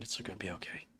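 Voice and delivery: soft unvoiced whisper; whispered comfort. Streamer comforting his squirrel Nutty in a whisper. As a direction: whisper it to soothe a pet.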